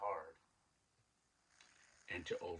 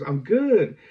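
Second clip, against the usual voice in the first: happy reply; 11 semitones higher, 28 dB louder than usual. Retold or imitated. Kid answering happily.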